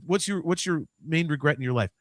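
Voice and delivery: ordinary delivery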